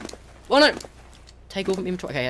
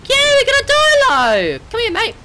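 triumphant cheer; 8 dB louder than usual. Real, not acted. Successfully tames a dinosaur.

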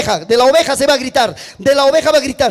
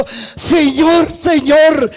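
urgent shouted call to his master; messenger calling out; 3 semitones higher, 3 dB louder, much slower than usual. Acted. (mensajero llamando a voces)

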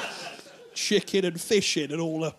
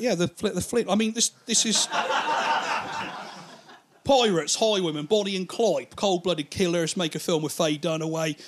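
ordinary talk

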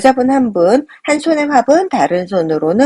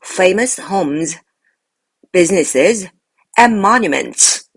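slow list, "famous homes" and "businesses" lifted, "monuments" falling; parallel list intonation; much slower than usual. Shown as a demonstration. Teacher shows learners how the list can be read, slow list intonation. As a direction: pause after "homes" and "businesses", close down on "and monuments". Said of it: "이렇게 읽을 수 있겠습니다".

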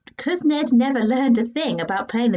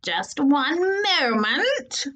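very slow, higher strained voice; slow drawn-out reply; 5 semitones higher, much slower than usual. Acted. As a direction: drag it out, holding it in. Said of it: "replied Sloth".

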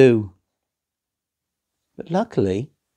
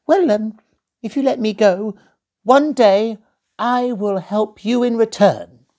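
very high, timid squeak; little mouse; 11 semitones higher, 4 dB louder than usual. Acted. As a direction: promise bravely in a tiny voice. Mouse offers to help.

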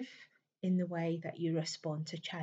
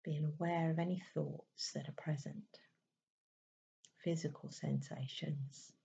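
calm, unhurried meditation voice with long pauses; even, settled delivery in short, spaced phrases; guided meditation; 3 dB quieter than usual. Acted. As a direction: gently guide them inward, leaving space between phrases.

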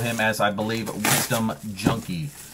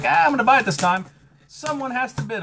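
eager; 12 semitones higher, faster than usual. Retold or imitated. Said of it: "when I was like".

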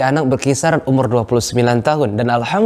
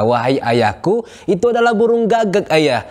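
annoyed answer, pitched higher; son, tired of the repeated question, answers irritated and higher; a bit irritated; 8 semitones higher than usual. Acted. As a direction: let the irritation rise. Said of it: "dengan intonasi yang sedikit lebih tinggi".

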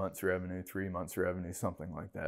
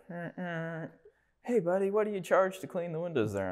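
high-pitched stranger hailing from behind; stranger calling out; 10 semitones higher than usual. Retold or imitated. Stranger in his SUV hails the window washer, high calling voice. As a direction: call over to him, pitched well up, a bit pushy. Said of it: "someone behind me, like".

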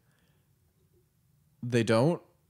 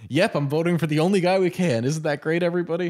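higher, louder mock-cheery crowd call; mock cheer; 7 semitones higher, 7 dB louder than usual. Washington's voter cheering the one-candidate election, mock-cheery. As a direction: ham up a fake rally cheer. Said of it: "It was just like".

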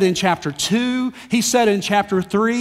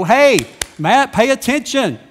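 loud, higher imitated call to attention; God's sharp call; 5 semitones higher, 7 dB louder than usual. God to distracted preacher, sharp loud call to attention. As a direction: snap a distracted person back. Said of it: "snap me back to it and go".

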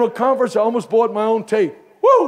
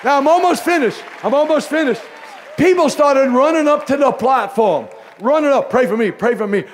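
loud, high, driving preacher delivery; preaching; 5 semitones higher, 3 dB louder than usual. Real, not acted. Pastor driving his story home to the congregation, preaching loud. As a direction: build the sermon toward its peak.

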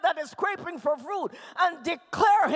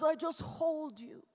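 soft, lowered voice after shouting; tender reassurance; 3 semitones lower, 6 dB quieter than usual. Acted. God letting a hurting child vent, softly reassuring. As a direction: hold the listener gently with the words.

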